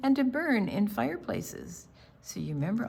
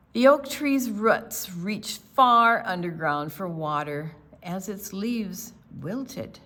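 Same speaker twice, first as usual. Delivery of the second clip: clear, steady storybook reading, a bit louder; story narration; 6 dB louder than usual. A narrator's reading. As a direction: read the picture book to the class. Read-aloud story narration.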